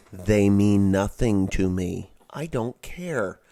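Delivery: loud, slow and blunt; emphatic dismissal. Real, not acted. Man dismissing migrants from abroad, loud emphatic dismissal. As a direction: hammer out each word loudly, slowly. Ranting about border crossers.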